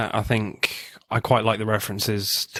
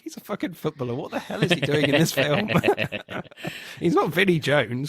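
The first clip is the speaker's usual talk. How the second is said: higher-pitched, laughing through the words; laughing disbelief; 6 semitones higher than usual. Real, not acted. Podcaster mocking a footballer's casting to co-host, laughing disbelief. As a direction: crack up in amused incredulity.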